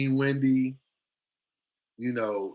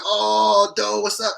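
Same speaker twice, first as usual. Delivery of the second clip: high, loud hype hello; excited greeting; 8 semitones higher, 5 dB louder, much slower than usual. Retold or imitated. Friends hyping him up at the door, loud greeting.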